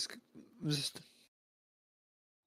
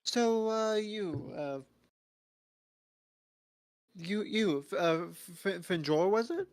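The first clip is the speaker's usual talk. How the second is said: higher, stammering nervous question; 5 semitones higher, slower than usual. Acted. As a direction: stumble over the name nervously. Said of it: "I was like".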